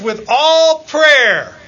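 "With all prayer" slow, loud and high; stressed quote. Acted. Preacher quoting Paul to the church, slow and emphatic. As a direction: lean hard into "all" and stretch the line. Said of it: "You know, he says".

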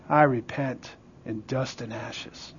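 very low, quiet, humbled confession; hushed repentance. Acted. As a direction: drop to a near-murmur, slow and penitent. Job's humbled repentance, voice drops right down.

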